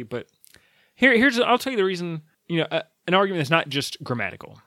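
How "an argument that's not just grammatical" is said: normal talking voice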